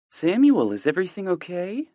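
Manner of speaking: a worried mom on a late call; worried mother. A narrator's reading. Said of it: "her voice laced with worry".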